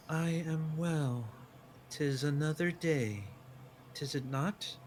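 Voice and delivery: lightly sing-song, slow creature greeting. Acted. The creature Valinian greeting Mabel in a lightly sing-song voice. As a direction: let it lilt slowly. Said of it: "in this sing-song voice".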